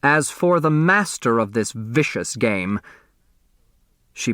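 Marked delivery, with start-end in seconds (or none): none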